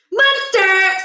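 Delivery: a keen pupil showing off; eager answer. A narrator's reading.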